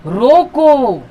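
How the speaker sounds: loud, drawn-out "रोको"; रोको held long, then full stop; stop after रोको. Shown as a demonstration. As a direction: stretch रोको out as a command. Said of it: "यहां देखिए क्या कह रहा है".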